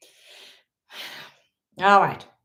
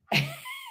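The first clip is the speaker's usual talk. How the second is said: squeaky laugh; 6 dB quieter than usual. Real, not acted.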